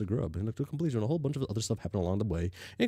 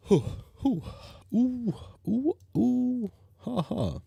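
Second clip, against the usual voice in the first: excited hooting; 14 semitones higher, much slower than usual. Real, not acted. Hooting exclamations.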